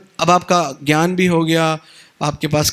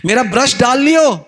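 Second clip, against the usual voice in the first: calling across the house, louder and higher; 6 semitones higher, 4 dB louder than usual. Retold or imitated. Husband calling out a reminder to his wife, raised voice. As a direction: shout the reminder at her.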